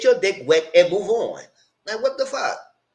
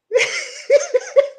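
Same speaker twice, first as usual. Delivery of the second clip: high laugh; 5 dB louder than usual. Real, not acted. Laughing at the show.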